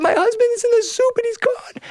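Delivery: very high comic wail; hammed-up tearful lament; mock crying. Wife telling the cat about her husband, hammed-up high wail. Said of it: "Why are you crying".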